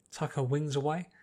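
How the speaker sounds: ordinary delivery, nothing marked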